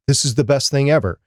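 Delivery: normal talking voice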